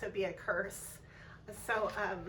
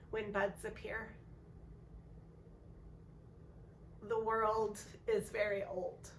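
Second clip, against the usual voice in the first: gentle spring poem read with long pauses. Acted. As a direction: savour each line of the verse. Reads a spring poem aloud.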